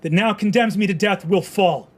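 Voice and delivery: loud, high, defiant last words; defiant speech. Acted. The condemned man defies the crowd, loud and proud. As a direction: hurl defiance at the crowd from the gallows. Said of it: "He looks out at you and goes".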